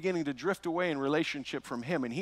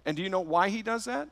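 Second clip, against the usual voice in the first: normal talking voice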